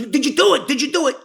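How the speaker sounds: pressing hard for the answer; eager demand. Retold or imitated.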